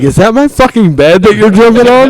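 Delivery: very high, loud, incredulous mock shout; hammed-up indignant yell; outraged father imitation. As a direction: mimic a dad's appalled outburst. Acted outraged father in retold story.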